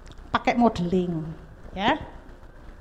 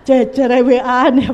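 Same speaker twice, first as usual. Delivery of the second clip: laughing; 9 dB louder, slower than usual. Real, not acted. Laughing at texting next door.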